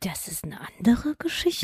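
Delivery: hushed storyteller's aside; soft, voiceless playful whisper; mock-mysterious whisper. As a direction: whisper it like a teaser for another tale. Hushed storyteller aside after joke.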